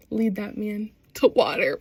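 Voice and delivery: ordinary talk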